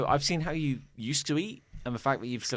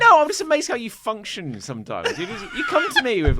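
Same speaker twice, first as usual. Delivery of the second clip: laughing protest; 8 semitones higher, 6 dB louder than usual. Real, not acted.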